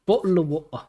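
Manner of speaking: exaggerated British glottal stops; playful mock-British, t's swallowed; British accent. Shown as a demonstration. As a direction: show off a caricatured British accent. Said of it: "Do a British accent".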